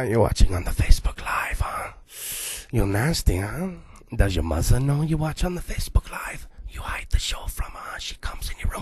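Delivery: low, breathy creepy whisper to the camera. Acted. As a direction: lean in and whisper like a pervy secret-keeper. Host teases Facebook Live viewers.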